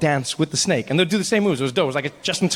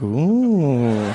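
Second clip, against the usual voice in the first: long, swooping ooh; mock-impressed drawn-out ooh; intrigued "ooh"; 3 semitones lower, 3 dB louder than usual. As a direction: coo it like an awed spectator. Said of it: "And I'm like".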